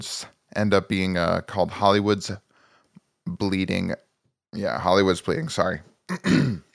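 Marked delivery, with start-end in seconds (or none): 6.0-6.6 throat catch after choking; choking on spit. Real, not acted. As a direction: clears throat mid-word. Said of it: "choking on my, uh, spit".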